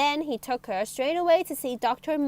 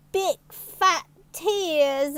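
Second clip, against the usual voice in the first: mock crying; 5 semitones higher, 6 dB louder, much slower than usual. A narrator's reading. Narrator mimics crying.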